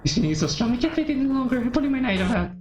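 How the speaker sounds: high-pitched obsessive character voice; obsessed girl, carried away. Acted. Girl pulling out her knife, carried away. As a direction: keep it high and overexcited.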